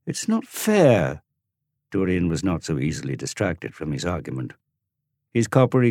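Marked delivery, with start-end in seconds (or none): 0.1-1.2 put-on high, slow, drawn-out child's complaint; sulky boy. Dorian to Tom, high slow sulky protest. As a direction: sulk it out slowly.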